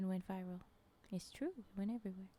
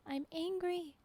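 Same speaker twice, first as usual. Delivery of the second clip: mock angry; 7 semitones higher than usual. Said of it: "I forgot that I'm angry".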